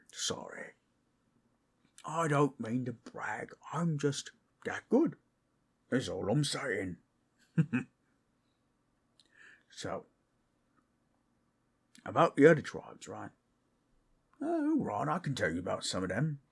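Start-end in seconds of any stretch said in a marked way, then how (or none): 0.1-0.7 sheepish whispered sorry; whispering. Acted. Apologizes after a loud laugh. 14.4-15.1 high startled oh right; sudden realization. Acted. Suddenly remembers the topic.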